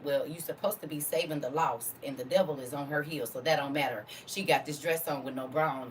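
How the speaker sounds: ordinary delivery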